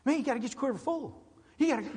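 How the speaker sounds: high, fast, excitable nagging voice; mimicking a spazzy church member; hyper church member. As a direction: rattle it off like an overeager busybody. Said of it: "now, he was a spaz".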